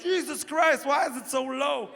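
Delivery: imitating an exasperated girlfriend; exasperated complaint. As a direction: complain in a high, put-upon voice. Acting out her exasperated complaint.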